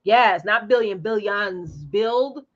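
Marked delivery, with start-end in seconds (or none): none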